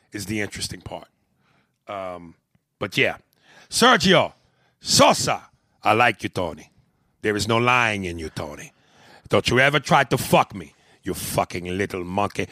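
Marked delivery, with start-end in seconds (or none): 3.7-12.5 mock gangster. Podcast host acting out a gangster phone call, hammed-up. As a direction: bark it down the phone like a movie gangster.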